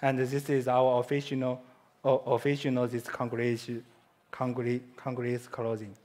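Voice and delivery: normal talking voice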